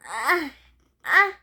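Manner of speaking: acted pre-sneeze gasps cutting off the words; building sneeze. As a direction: break off as a pretend sneeze builds. Character about to sneeze.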